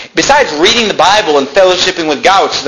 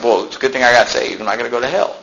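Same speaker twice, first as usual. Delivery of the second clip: quieter, shrugging and unexcited; unexcited convert; 4 semitones lower, 5 dB quieter, faster than usual. Retold or imitated. A new convert who isn't excited, speaking quietly and flatly. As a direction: say it like it barely matters. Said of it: "Maybe they're not so excited".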